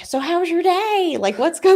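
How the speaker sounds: high-pitched imitation of chatty strangers; mock small talk. Strangers making small talk with an infuriated man, mock chirpy. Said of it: "trying to, like, make small talk".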